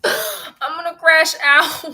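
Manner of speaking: half-laughing fake sob, slow; comic wail over the bank charge; mock crying. Real, not acted. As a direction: whimper it out like you're about to cry. Bank charge, real distress.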